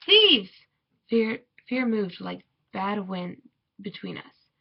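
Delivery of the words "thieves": high, loud shout of alarm; alarmed cry. A narrator's reading. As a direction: cry out a sudden warning. Alarm cry in narrated story.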